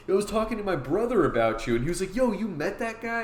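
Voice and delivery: high-pitched, amazed fan imitation; excited recognition. Bartender recognizing the comic's artist, excited. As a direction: act out a bartender thrilled to meet the artist.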